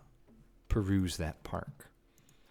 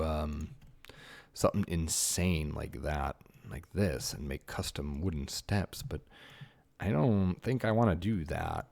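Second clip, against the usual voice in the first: usual speaking voice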